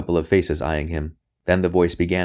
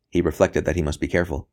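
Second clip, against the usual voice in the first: plain reading voice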